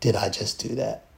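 low, quiet, whispered regret; embarrassed whisper. Retold or imitated. Backstage, embarrassed at himself, whispering low and quiet. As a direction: hush the words in shame. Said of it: "And I was like".

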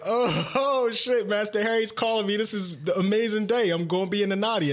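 high, fast, laughing excitement; excited high gushing; giddy excitement. Retold or imitated. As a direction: gush like it's the best day ever. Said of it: "I was like".

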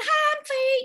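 high-pitched cheery hello; perky greeting. Retold or imitated. Newcomer meeting friend's girlfriend in the car, perky greeting. Retold greeting to new acquaintances.

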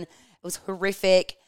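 usual speaking voice

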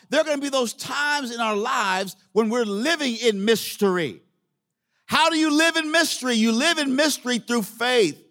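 high-pitched, emphatic sermon delivery; preaching. Real, not acted. Preaches to the congregation.

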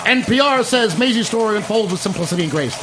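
high, rapid newsreel announcer; Walter Winchell voice. Pretend. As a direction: rattle off reviews like a 1940s gossip newscaster. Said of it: "I wanna do my Walter Winchell voice".

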